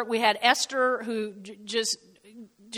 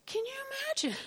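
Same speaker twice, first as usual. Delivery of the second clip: incredulous; 14 semitones higher, 10 dB quieter, much faster than usual. Real, not acted. Incredulous aside.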